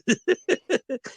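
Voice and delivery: bursts into a high-pitched laugh; a big laugh, way up high. Real, not acted. Laughing at the coach recognizing him from the podcast.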